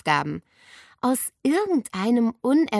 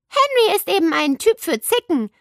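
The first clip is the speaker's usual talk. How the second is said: higher and louder, mildly smug; a bit know-it-all; 11 semitones higher, 8 dB louder than usual. A narrator's reading. Nele lecturing her friends, a little know-it-all. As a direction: state it like you know better. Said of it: "sagte sie ein wenig besserwisserisch".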